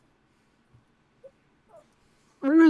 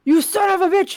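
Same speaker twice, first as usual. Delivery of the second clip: high, louder narrated outburst; mock scream; 11 semitones higher, 5 dB louder than usual. A narrator's reading. Dreamer screams at the villain, high mock scream. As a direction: cry it out high, short of a full scream. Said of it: "you scream".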